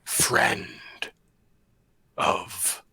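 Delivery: loud, slow, low boom; booming monster voice. Acted. Dragon announces itself to intruders, loud and booming. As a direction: boom it out slowly, shaking the foundations. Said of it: "a loud, booming voice that shakes".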